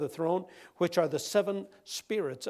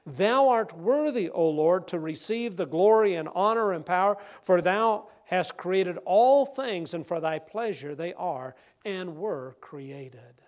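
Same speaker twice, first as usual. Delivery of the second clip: solemn praise reading; 5 dB louder than usual. Acted. Elders' praise quoted.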